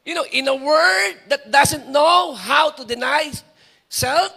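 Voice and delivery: loud, slow preaching; full-force preaching. Acted. Preacher to congregation, loud full-force preaching. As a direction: drive the point home to the congregation.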